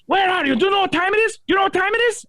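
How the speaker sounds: very high, frantic imitation of a lady; mock freaking out. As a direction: caricature a woman in a flap about the time. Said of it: "this lady was freaking out".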